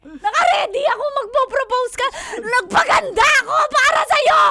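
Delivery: loud, high, tearful lament; crying shout. Real, not acted. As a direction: wail your hurt and disappointment. Betrayed partner cries out hurt.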